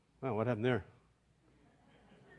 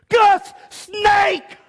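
sudden high shout, much higher and louder; shouting a warning; 14 dB louder than usual. Retold or imitated. Randy startling Gus with a fake warning, sudden high shout. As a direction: yell the alarm to startle him. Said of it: "and Randy goes".

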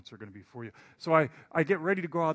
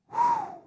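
nervous blow; 6 dB louder than usual. Retold or imitated. Steeling himself at front door.

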